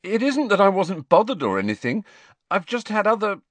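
high, loud, urgent excuse; a little desperate. A narrator's reading. Pupil excuses himself to disappointed headmaster, a little desperately. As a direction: protest your case, pitched well up. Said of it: "he said, a little desperately".

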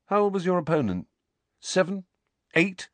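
high, fast mocking questions. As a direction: sneer the guesses up high. Harry mocking Dudley's boxing.

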